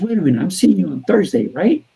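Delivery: high, loud, quick protest rising into a question; imitated eager insistence. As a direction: act out an eager client double-checking urgently. Client's eager reply acted out.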